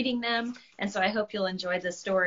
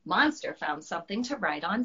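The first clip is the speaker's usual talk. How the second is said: ordinary delivery, nothing marked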